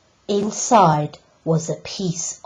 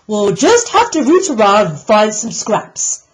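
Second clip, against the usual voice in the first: louder, higher snorting pig voice; snorted; 5 semitones higher, 6 dB louder than usual. Acted. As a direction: snort out the plan, fed up. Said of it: "snorted Mister Piggot".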